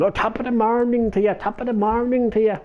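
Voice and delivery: high, hammed-up stage-Irish lilt; mock stage Irish. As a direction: lay on the fake Irish charm, mocking it. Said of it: "you're not gonna get this typical kind of".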